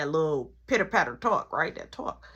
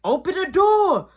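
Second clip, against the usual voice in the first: high, loud demand; calling out; 8 semitones higher, 6 dB louder than usual. Acted. Sister outside brother's room, hollered demand. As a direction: holler it through a shut door.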